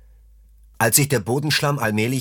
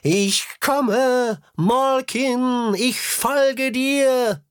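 very high, slow, ringing call; shrill trumpeting call; 13 semitones higher, much slower than usual. A narrator's reading. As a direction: trumpet it out to the leader above. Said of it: "Ihr schrilles Trompeten".

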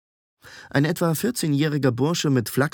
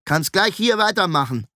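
higher, dismissive command; bossy order; 7 semitones higher than usual. A narrator's reading. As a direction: order the newcomer to take over. Hostile apprentice orders newcomer around.

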